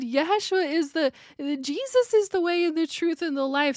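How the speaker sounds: high, mocking parroting of the believers; mocking quote. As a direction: parody the crowd repeating the scripture. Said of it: "because Yeshua said".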